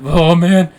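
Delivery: high, loud mock wail; imitated panicked snap. Storyteller imitating his friend snapping, panicked outcry. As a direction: imitate a sudden stoned freak-out. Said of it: "he just fucking snaps".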